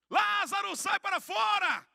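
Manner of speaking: high, loud cry with drawn-out final words; commanding shout. Retold or imitated. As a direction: call the dead man out at full voice. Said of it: "ele dá um grito".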